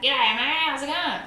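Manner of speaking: hammed-up Aussie "G'day, mate". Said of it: "the stereotypical".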